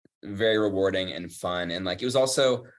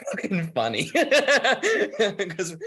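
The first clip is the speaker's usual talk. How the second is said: laughing; 11 semitones higher, 5 dB louder, much slower than usual. Real, not acted. Laughing recalling the shoot.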